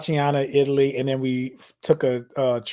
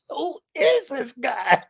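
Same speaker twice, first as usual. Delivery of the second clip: baffled laugh; 10 semitones higher, slower than usual. Retold or imitated. Teller baffled by the guide.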